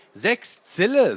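put-on a boy announcing his card; Lutz playing cards. A narrator's reading. Boy voiced in card game. (Lutz ruft langsam und hoch seine Karte)

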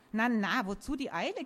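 ordinary delivery, nothing marked

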